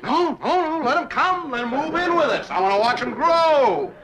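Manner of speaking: high, loud mock-eager rant; sarcastic outburst. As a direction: rant sarcastically at the top of your range. Sarcastic outburst over Elroy.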